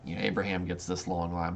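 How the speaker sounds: normal talking voice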